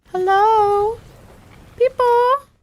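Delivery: very high, drawn-out call. Pretend. Streamer looking for other players, calling out high. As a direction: call out high, searching for someone.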